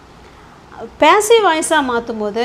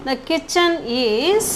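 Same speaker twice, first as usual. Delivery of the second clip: very slow, last word drawn out and rising; slow dictation; 2 semitones higher, much slower than usual. Shown as a demonstration. Teacher adding a helping verb to the kitchen sentence, slow dictation. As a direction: leave the phrase hanging, unfinished.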